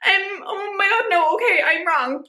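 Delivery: high, slow, hesitant mock fluster; flustered backing down. Imitating herself in person, flustered and backing down. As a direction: back down nervously. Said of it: "I would be like".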